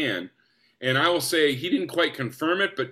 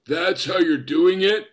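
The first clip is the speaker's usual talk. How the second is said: slow, drawn-out mock disbelief; teasing; 2 semitones higher, 4 dB louder, slower than usual. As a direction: needle him with a slow, incredulous question. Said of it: "he just started teasing me".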